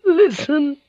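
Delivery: put-on very high, frail old lady; hoarse and frail. A narrator's reading. Old lady addresses the watching samurai, hoarse and frail. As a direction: call for attention in a hoarse, frail voice. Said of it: "she said, her voice hoarse and frail".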